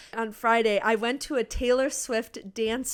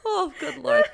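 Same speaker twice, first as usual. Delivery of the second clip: laughing; 8 semitones higher, 4 dB louder than usual. Real, not acted. Both laughing, giddy.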